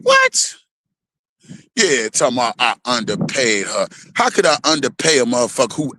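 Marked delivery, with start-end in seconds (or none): 0.0-0.6 high, shocked yelp of what. Retold or imitated. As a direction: squeak out what in disbelief. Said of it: "I was like".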